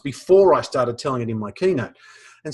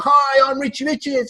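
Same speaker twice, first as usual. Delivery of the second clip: put-on high, bright kid voice introducing himself; proud little boy; 11 semitones higher than usual. Schoolboy introducing himself to classmates, high kid voice. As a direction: say your name like a proud schoolboy.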